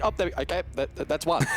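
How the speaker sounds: sudden jump up in pitch, caught off guard; a caster yelping at a surprise kill; excited. Real, not acted. Caster reacting live to a surprise kill, excited.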